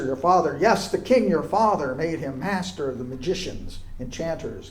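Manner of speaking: normal talking voice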